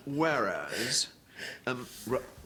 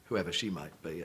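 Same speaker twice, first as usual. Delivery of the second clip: ordinary talk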